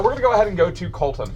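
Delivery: usual speaking voice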